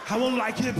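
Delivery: "won't" kelimesi yüksek ve bağırarak, abartılı aksiyon yıldızı taklidi; mock action-star voice. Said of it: "picture the same scene with Stallone and Schwarzenegger".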